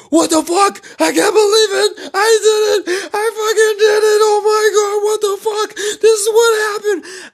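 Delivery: very high, loud ecstatic scream; screaming in disbelief. Retold or imitated. Man alone in his car after getting her number, elated screaming. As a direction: scream it out, overjoyed and incredulous. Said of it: "I start screaming in my car".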